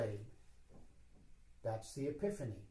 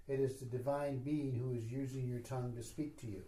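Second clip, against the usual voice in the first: ordinary talk, nothing marked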